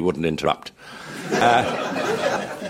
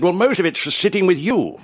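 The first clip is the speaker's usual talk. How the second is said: Willie's retort; 12 semitones higher than usual. Retold or imitated.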